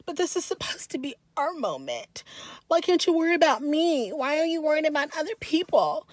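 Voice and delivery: high, whiny imitation of a tearful plea; mock tearful whine. Recapping Amber crying to Daniel, mock tearful whine. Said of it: "she's got the tears in her eyes".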